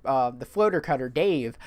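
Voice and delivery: ordinary talk, nothing marked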